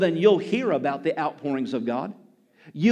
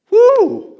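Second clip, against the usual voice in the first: loud, high-pitched "woo"; exultant whoop; 13 dB louder than usual. Real, not acted. Preacher struck by his own point, whooping loudly. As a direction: let out a piercing preacher's whoop.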